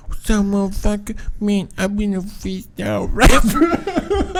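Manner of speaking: high would-be freestyle rapper, slowing down; coworker impression. Imitating the work guy bragging, high put-on voice. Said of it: "And he's like".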